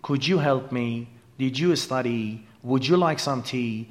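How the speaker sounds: "Could you", "Did you" and "Would you" each said slowly with a light j blended into the you; soft j sound. Shown as a demonstration. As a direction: demonstrate the soft j between the word and "you". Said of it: "این صدای جی خفیفی که بعد از کلمات could". (صدای ج خفیف بین کلمه و you)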